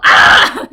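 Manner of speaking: very loud tic scream; sudden scream. Retold or imitated. Ticcing beside a sleeping baby, sudden loud scream.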